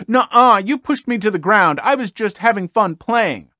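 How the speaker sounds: put-on whiny boy talking back; bratty kid. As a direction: protest like a sulky little brat. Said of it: "The child then says".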